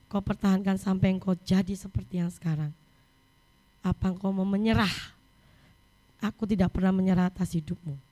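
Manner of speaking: low, softened, earnest questioning; gentle reminder. Retold or imitated. Voices God's inner reminder to mother. (suara teguran lembut, rendah dan tenang)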